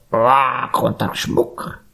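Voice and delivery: raspy magpie squawk; croaky bird voice; croaking magpie. A narrator's reading. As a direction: open on a high caw, then croak the words. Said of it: "krächzte die Elster".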